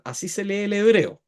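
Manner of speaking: ordinary talk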